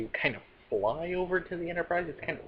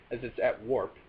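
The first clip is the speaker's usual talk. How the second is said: usual speaking voice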